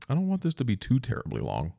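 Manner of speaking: normal talking voice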